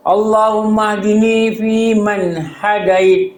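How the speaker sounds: slow, drawn-out prayer chant; felt qunut recitation. Shown as a demonstration. Preacher showing the congregation how to recite qunut with feeling. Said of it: "mesti ada feel baca kunut".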